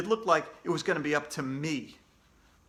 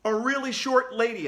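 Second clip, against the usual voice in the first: shouted; 7 semitones higher, 5 dB louder than usual. A narrator's reading. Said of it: "I shouted".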